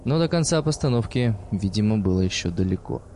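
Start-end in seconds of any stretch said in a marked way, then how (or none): none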